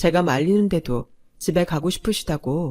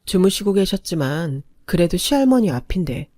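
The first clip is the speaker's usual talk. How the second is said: usual speaking voice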